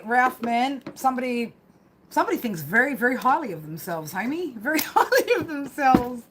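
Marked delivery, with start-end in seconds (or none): 4.5-6.2 higher, laughing through the words; laughing jibe. Real, not acted. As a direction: break into laughter mid-phrase. Laughing while repeating a jibe.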